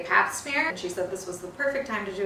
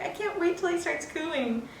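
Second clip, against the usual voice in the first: eager; 9 semitones higher than usual. Retold or imitated. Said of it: "I was like".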